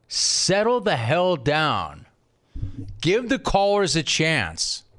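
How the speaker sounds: slow, each word weighted; slow deliberate settle down; slow drawn-out scold. Real, not acted. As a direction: draw it out firmly. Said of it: "telling producer to settle down".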